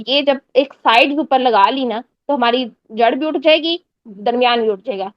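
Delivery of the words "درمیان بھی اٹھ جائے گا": ordinary talk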